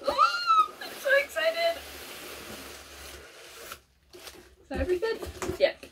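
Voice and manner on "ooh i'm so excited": squeaky, breathless thrill; gasp, then squeal it out high; high-pitched squeal of excitement. Real, not acted. Unboxing fan spotting the box, squealing high.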